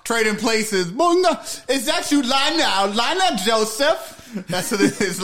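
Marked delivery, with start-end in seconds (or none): 0.9-4.4 high, slow Jamaican-style movie voice; drawn out and way up high, like the film; movie character voice. Retold or imitated. Quoting Trading Places to his friend, high slow Jamaican-style voice.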